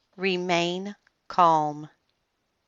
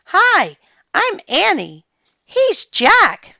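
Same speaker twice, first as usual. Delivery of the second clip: high and loud, calling out to a sea creature; a bright child's call, shouted high; excited yell; 14 semitones higher, 10 dB louder, slower than usual. A narrator's reading. Girl calls out her name to the octopus, loud and high. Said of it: "She yelled to the octopus".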